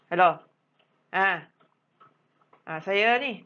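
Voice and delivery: loud, careless hello with hesitant ums; rude phone answer. Shown as a demonstration. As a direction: answer the phone sloppily, as the bad example. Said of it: "jangan jawab macam tu". (jawapan telefon yang kasar dan tidak jelas)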